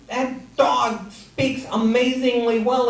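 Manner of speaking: high, drawn-out acted amazement; astonished and insisting; incredulous protest. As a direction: stretch the words in disbelief. Buyer protests the low price.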